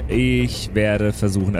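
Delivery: normal talking voice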